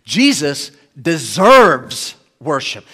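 slow, with "deserves" drawn out forcefully; stress on "deserves". Shown as a demonstration. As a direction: punch "deserves" hard and hold it. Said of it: "every word is emphasized".

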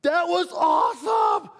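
high, loud, drawn-out cheer of delight; excited shout. Retold or imitated. Preacher acting out his own thrilled response. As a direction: shout it like you just saw a miracle. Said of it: "what would my response be to that? Like".

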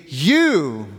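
YOU drawn out, pitch arching up and down; emphatic YOU. Shown as a demonstration. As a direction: lean long and hard on YOU. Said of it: "But it's also emphatic, meaning".

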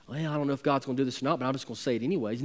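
mock the timid doubter, hurried and soft; fast, soft mock of a shaky voice. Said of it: "with a shaky voice".

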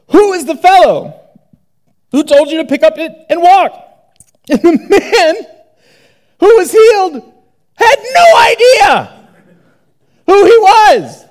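rising preacher fervor; building excitement. Acted. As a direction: climb to a near shout. Mounting disbelief retelling exchange.

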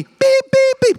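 playful imitated bleep; censor beep. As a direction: bleep out the curse like TV. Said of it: "they have to beep him out".

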